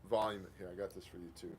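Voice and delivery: normal talking voice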